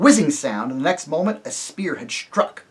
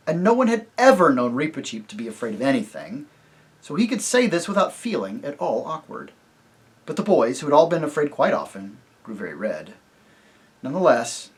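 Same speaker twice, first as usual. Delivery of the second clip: usual speaking voice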